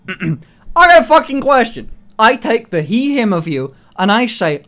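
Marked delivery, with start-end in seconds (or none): none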